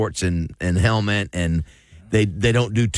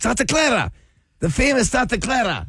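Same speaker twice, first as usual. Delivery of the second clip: "Santa Clara" with a higher, rolled Spanish sound, like a local; put-on Cuban Spanish accent; 13 semitones higher than usual. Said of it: "he's doing the thing again".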